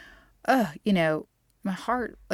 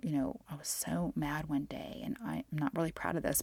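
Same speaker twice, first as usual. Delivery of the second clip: usual speaking voice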